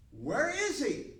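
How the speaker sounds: loud, high, drawn-out demand. Acted. Priests confront officers who returned without Jesus, demanding. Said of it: "the priests demanded".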